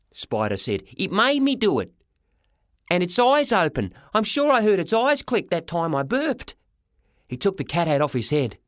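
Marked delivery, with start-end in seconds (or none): 1.0-6.5 put-on high-pitched kid explaining urgently; high boyish protest; alarmed boy. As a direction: insist in a high, worked-up voice. Boy blames the hat.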